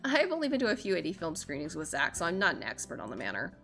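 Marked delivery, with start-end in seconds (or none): none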